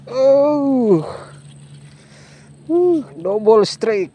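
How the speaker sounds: long, high, loud whoop; excited whoop. Real, not acted. Angler whoops to viewers at a double strike, excited. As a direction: stretch out a high cry of triumph. Two fish hooked at once.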